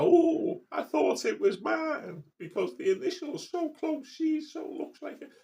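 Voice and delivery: shrill-free high mock of mum's excuse; mocking mother. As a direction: send up her fake innocence. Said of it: "and go".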